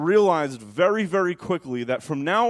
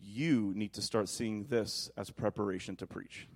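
lower, much softer gentle voice; voice of the Holy Spirit; 6 semitones lower, 8 dB quieter than usual. Retold or imitated. The Holy Spirit to the pastor, soft and low. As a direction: speak softly as God's calm voice. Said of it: "the Holy Spirit said".

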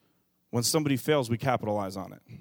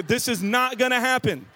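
high, fed-up declaration; exasperated outburst; 9 semitones higher than usual. Retold or imitated. Dad reacting to the parroting toy, exasperated.

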